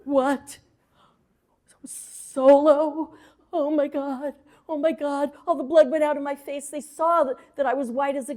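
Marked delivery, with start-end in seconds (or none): 0.0-5.3 a startled, higher yelp of disbelief; shocked disbelief. Retold or imitated. Learning she must dance alone, a startled rise. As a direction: blurt it out in sudden panic.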